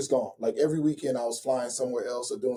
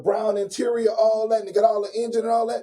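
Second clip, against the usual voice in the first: higher, louder show-off delivery; hyped-up bragging; 7 semitones higher, 5 dB louder than usual. Retold or imitated. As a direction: gush over the new car's features. Acts out his excitement over car.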